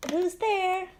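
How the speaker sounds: high sing-song question; playful singsong for a little kid; talking to a toddler. Acted. Playing doorbell game with toddler.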